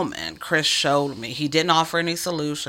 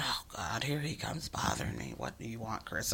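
soft, muttered inner voice; inner thought; 6 semitones lower, 14 dB quieter than usual. Retold or imitated. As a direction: grumble it quietly to yourself. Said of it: "I'm thinking this in my mind".

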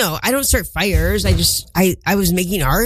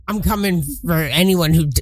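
ordinary delivery

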